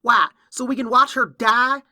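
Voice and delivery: high, loud, angry challenge; indignant outburst. Acted. Upset filly challenging Applejack about her dying granny, indignant outburst.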